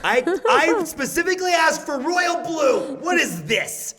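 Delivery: high, loud, slow fussy artist; mock diva artist. Dad voicing his son as a fussy, high, loud artist. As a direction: play a demanding painter.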